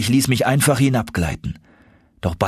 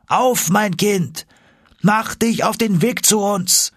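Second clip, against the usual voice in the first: higher, carrying call from far down a tunnel; calling through tunnels; 13 semitones higher than usual. Acted. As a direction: voice the uncle shouting across the rock. Uncle calls through the tunnels.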